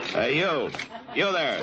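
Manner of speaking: calling out. Acted. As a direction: call out to get his attention. Marshal calling out to rider.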